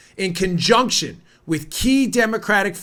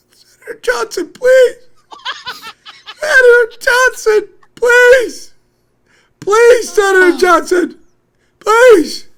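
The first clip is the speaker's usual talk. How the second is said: much higher, much louder, shrill mock pleading; mocking whiny anchor; 11 dB louder, slower than usual. Host mocks the anchor begging the senator, high and loud. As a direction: ham up a panicked anchor begging. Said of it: "I'm waiting for him to go".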